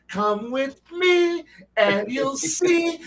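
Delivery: high, slow grandiose wizard voice; a sweeping mock-mystical wizard invitation; Elminster. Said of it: "Elminster come walking in there and be like".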